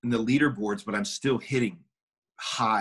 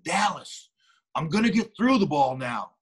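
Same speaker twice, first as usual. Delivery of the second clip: higher-pitched call to the coach; 6 semitones higher than usual. Retold or imitated. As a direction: call out a cocky announcement, pitch raised. Retold calling out to coach.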